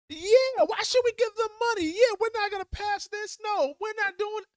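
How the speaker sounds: a cartoonish gullible voter chiming in; dopey agreeing voter. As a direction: play the riled-up voter who just bought the pitch. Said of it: "And they're gonna be like".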